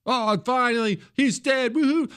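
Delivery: high mocking cheer; mock gleeful celebrant. Host mocking people cheering a death. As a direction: sneer it in a sing-song gloating voice. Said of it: "they're all losing their jobs".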